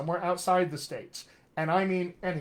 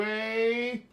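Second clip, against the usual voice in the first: higher-pitched Georgia accent; Atlanta, Georgia accent; 5 semitones higher, slower than usual. Shown as a demonstration. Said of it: "go from a general accent all the way to".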